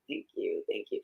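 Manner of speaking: very soft, tender thank-you; retold touched thanks. Mother retelling thanks for Mother's Day flowers, soft and touched. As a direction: murmur it softly, as if moved.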